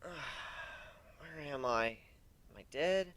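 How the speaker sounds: slow, dazed and soft; drowsy bewildered mumble; groggy waking murmur. Acted. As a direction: come to slowly, unsure where you are. Waking up groggy after injury.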